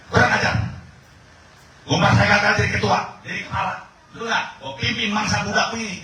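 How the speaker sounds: high, loud furious ranting. Acted. An angry man rants that he will lead, high and loud. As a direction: boil over with rage.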